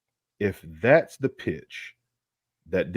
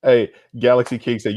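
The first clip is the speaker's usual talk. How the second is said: usual speaking voice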